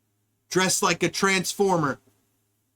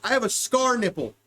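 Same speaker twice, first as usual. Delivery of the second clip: ordinary talk, nothing marked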